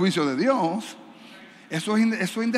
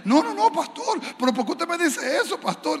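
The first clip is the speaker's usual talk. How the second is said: hermana alarmada; 9 semitones higher than usual. Retold or imitated. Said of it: "hermana responde alarmada".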